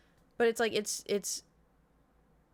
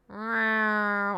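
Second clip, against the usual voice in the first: loud imitated cat meow; 2 semitones higher, 6 dB louder than usual. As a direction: mimic the cat's protest. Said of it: "he goes".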